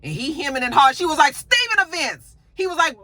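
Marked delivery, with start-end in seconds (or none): 1.3-2.3 sharper insistent repeat. Retold or imitated. Jax repeating demand.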